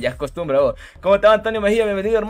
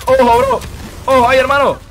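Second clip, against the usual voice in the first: loud warning; 5 semitones higher, 7 dB louder than usual. Real, not acted. Streamer yelling a warning during a close fight. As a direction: shout the warning, higher and louder.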